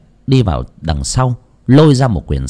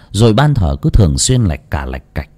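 ordinary delivery

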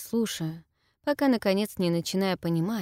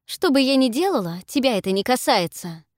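sharp, loud retort, higher; snapping back; 6 semitones higher, 6 dB louder than usual. A narrator's reading. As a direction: snap it back at her. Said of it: "огрызаюсь я".

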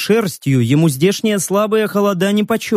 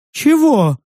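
high surprised creature; 9 semitones higher than usual. A narrator's reading.

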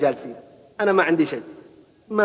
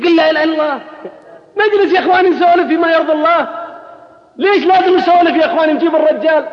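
higher, louder emphatic preaching push; fervent preaching; 9 semitones higher, 4 dB louder than usual. Real, not acted. Preacher urging the gathered youth, fervent raised voice. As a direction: lift the voice to rouse the crowd.

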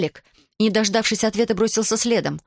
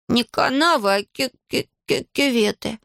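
higher-pitched stuttered correction; stammering; 6 semitones higher than usual. A narrator's reading. Иван corrects the word from the road, stammering. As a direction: catch repeatedly on the first syllable. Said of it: "заикаясь, поправил Иван".

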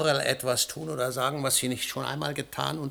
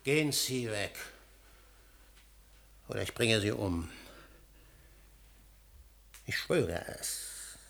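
much softer and lower, deadly menace; quiet threat; 4 semitones lower, 10 dB quieter than usual. Acted. Husband threatens the scientist, quiet deadly menace. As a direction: breathe the threat.

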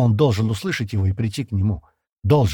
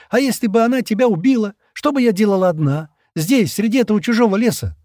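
much higher, louder weepy pleading; tearful outburst; 11 semitones higher, 5 dB louder than usual. Acted. Shira sobs to Stan about being left alone, tearful wail. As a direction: wail out her fears through tears.

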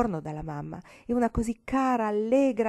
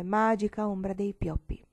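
plain reading voice

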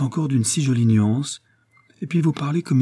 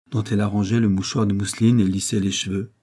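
ordinary delivery, nothing marked

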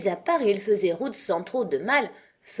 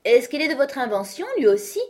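higher, louder, bright exclamation; cheerful voice; 7 semitones higher, 6 dB louder than usual. A narrator's reading. Alice changing the subject with the knight: cheerful. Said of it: "d'une voix gaie".

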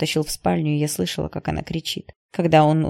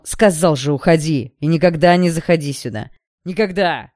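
loud, forceful order to leave; angry command, hammering each phrase; furious hiss; 6 dB louder than usual. A narrator's reading. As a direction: throw them out in a fury. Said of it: "разъярённо прошипел Игнат".

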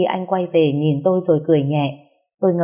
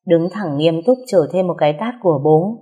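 ordinary delivery

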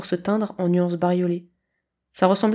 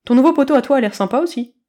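eager kitten; 5 semitones higher, 6 dB louder than usual. A narrator's reading.

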